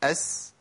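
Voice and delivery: أس with a soft hissing S; a light airy S in أس; sin demo. Shown as a demonstration. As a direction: let the S in أس breathe out. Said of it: "نقولهم على طول بقى".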